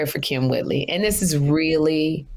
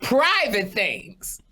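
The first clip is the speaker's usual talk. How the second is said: high, slow mock-eager gossip; teasing mimic of a blabbing friend; 10 semitones higher, slower than usual. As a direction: drag out the eager tattling. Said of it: "You go".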